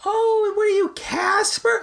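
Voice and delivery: high, sing-song old lady voice mocking a kid's costume. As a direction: squeak it like a cooing old woman. Mocking older women, high voice.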